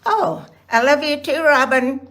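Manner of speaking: ordinary delivery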